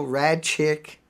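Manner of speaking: ordinary talk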